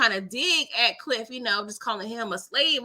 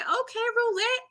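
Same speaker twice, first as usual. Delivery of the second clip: high sing-song okay; mock impressed; 11 semitones higher than usual. Cheering Roulette flossing around, high teasing lilt. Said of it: "I'm like".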